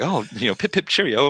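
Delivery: high, loud posh British put-on; mocking a stiff British gentleman; mock British accent. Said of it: "apparently you're British".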